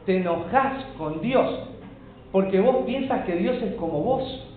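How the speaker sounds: ordinary talk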